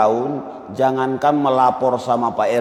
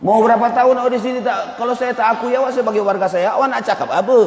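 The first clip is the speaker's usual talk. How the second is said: high, fast, hard-nosed official; the RT pushes back even harder; harsher RT; 8 semitones higher than usual. Retold or imitated. The RT refusing to recognize a resident, mock harsh. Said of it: "Rupanya Pak R T lebih keras".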